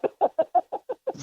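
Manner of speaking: high burst of real laughter. Real, not acted. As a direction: laugh out at the memory. Laughing at friend's claim.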